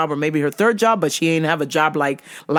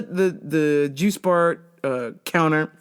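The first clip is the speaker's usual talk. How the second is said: ordinary talk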